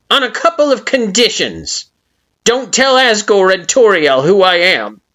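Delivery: high, loud flower voice setting terms; grudging Flowey. A narrator's reading. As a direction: lay down the terms. Flowey sets conditions.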